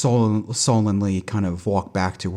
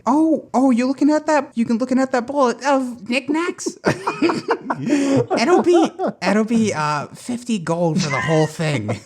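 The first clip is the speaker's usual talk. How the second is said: high, cracking granny voice; old lady voice; 14 semitones higher than usual. Acted. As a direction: hawk wares like a giggly old crone. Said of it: "What's happening to that voice?".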